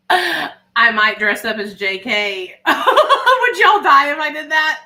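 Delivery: real laughter bubbling through the words; laughing hard while teasing viewers about a costume. Real, not acted. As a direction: giggle through the costume joke. Joking about Halloween costume.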